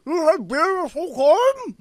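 high, loud words through a mouthful; mouth full. Acted. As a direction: talk around a mouthful of jerky. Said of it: "With my mouth still full".

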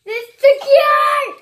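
slow loud shout. Real, not acted. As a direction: bellow it slowly. Shouting the repeated phrase.

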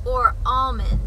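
ordinary talk

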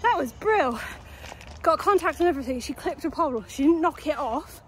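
puffing hard between phrases; out of breath. Real, not acted. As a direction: still panting from running the course. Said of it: "Get my breath back".

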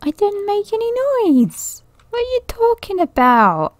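very high-pitched indignant squeal; put-on squeaky outrage; mock protest.

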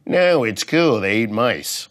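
high, loud eager defense; mock eager snake owner. Comic mimics snake owner defending pet, high and loud. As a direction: pipe up excited like a proud pet owner.

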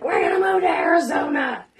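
high, loud retold outburst; mock angry shout. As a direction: yell it like a furious tantrum. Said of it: "I was so pissed off that I was like".

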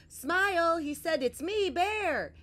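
high, loud, hopeful call. A narrator's reading. Bear calls Smile at the waterfall, loudly.

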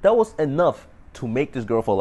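ordinary talk

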